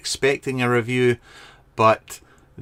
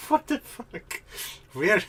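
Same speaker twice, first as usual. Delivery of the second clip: surprised; 9 semitones higher, 4 dB quieter, slower than usual. Real, not acted. Reacting to odd comment.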